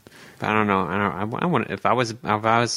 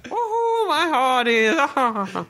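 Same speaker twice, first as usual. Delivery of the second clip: very high, piratey fake Irish; attempted Irish accent. Shown as a demonstration. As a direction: squeal a bad Irish pirate with a laugh. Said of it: "Let me hear it again".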